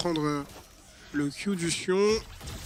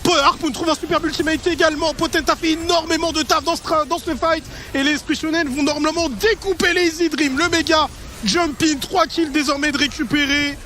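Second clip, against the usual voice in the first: excited esports caster; 7 semitones higher, 4 dB louder than usual. Real, not acted. Caster hype during big teamfight.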